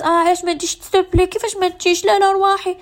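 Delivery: a high-pitched, pushy guilt-trip; mock nagging host. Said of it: "أنا مش نحكم الناس". (تقليد ساخر لمضيفة تلحّ بصوت عالي النبرة)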